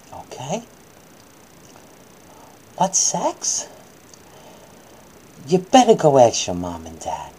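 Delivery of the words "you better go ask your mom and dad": usual speaking voice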